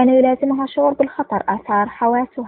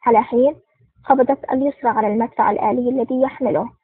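plain reading voice

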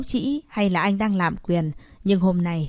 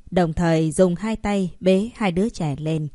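ordinary delivery

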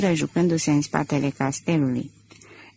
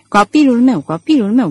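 put-on louder, frightened mother crying out for her child; mother's anguished cry; 5 semitones higher, 6 dB louder than usual. Mother panicking over her threatened son, anguished cry. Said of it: "bolborosea ea atât de slăbită".

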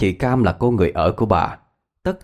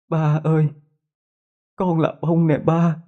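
high eerie voice of the dead daughter calling her father; ghost daughter; 8 semitones higher than usual. Acted.

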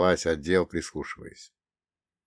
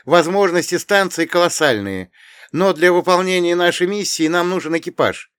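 earnest heroine; 9 semitones higher, 8 dB louder than usual. Acted. Irina pleads with captain.

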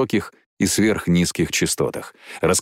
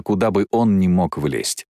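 usual speaking voice

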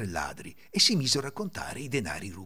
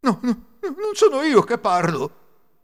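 high, loud, slow protest; pleading denial; 13 semitones higher, 9 dB louder, slower than usual. A narrator's reading. Accused thief denying to his companion, alarmed pleading. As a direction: protest your innocence desperately.

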